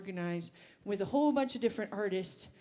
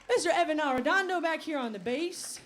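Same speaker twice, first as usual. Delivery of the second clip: normal talking voice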